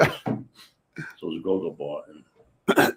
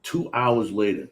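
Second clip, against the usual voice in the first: usual speaking voice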